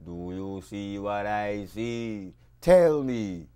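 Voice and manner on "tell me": sudden loud call, tell me; loud demand. Acted. Poet loudly demands the listeners answer. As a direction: bark it out at the crowd.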